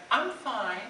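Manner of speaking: high-pitched, mock-brave reassurance; mortified. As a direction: imitate someone covering embarrassment. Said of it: "And I was so mortified".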